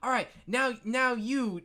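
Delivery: put-on high child's voice, addressing a player; high-pitched kid running the game; kid game master. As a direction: play a young game master calling on someone. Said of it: "D M is describing it".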